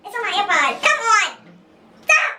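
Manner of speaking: high-pitched outburst at a misbehaving phone; a little angry. Real, not acted. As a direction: scold the phone in a high, exasperated voice. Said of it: "get angry. Just a little bit".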